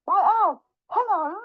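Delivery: high, singing-out hello; gleeful greeting. Acted. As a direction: hail them with exaggerated delight. Mouse boldly greets Owl.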